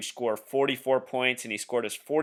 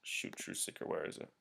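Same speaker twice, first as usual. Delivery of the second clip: very quiet, half-whispered aside; muttering to himself; 18 dB quieter than usual. Real, not acted. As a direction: mutter under your breath while searching. Searching, talking to himself.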